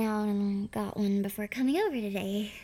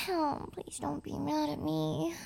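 usual speaking voice

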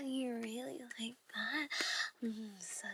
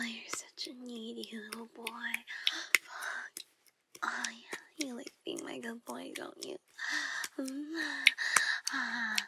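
sultry erotic whisper; seductive whisper; 4 dB louder than usual. Acted. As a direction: whisper it to a lover in the dark.

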